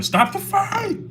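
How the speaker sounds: very high, drawn-out cry; mock yelled game voice. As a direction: imitate the game's high, slow yell. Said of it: "somebody's yelling".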